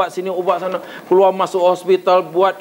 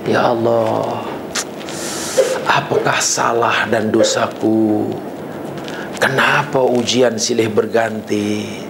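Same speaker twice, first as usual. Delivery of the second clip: complaining prayer; 4 semitones lower than usual. Retold or imitated. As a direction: moan to God about unfair trials. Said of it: "protes pada Allah".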